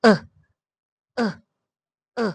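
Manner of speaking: ا held as a relaxed uh; drawn out schwa vowel ا; schwa demo. Shown as a demonstration. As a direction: say ا from the throat, lips loose. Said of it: "شوا sound کا sound کیا ہے؟".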